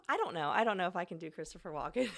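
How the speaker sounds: normal talking voice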